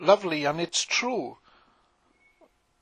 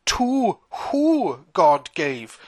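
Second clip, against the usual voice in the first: slow emphasis; much slower than usual. Acted. Points to who God gave.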